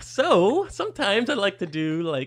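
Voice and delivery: very high vocal warm-up voice; silly high-pitched sing-song; exaggerated inflection. Shown as a demonstration. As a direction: pitch it way up, playfully overdone. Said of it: "Talking like that helps me".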